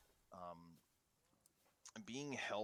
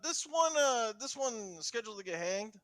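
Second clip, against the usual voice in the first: higher, louder guard asking; guard asks another guard about a hanging; 10 semitones higher, 8 dB louder than usual. Acted. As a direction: call the question over loudly. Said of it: "And he goes".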